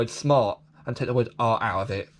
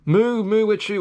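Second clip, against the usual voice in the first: playful sing-song; 7 semitones higher, 4 dB louder than usual. Pretend. Playful reading of toy name.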